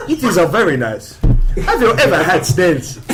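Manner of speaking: put-on overdone French accent, breaking into laughs; mocking French accent. Showing a friend how they sound, in mocking French accent. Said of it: "That's how you sound".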